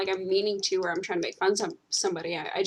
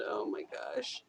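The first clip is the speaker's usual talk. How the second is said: low, much softer Oh my gosh; hushed aside; 8 semitones lower, 14 dB quieter, slower than usual. Real, not acted. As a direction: drop Oh my gosh almost to a breath. Aside about angry Canadians.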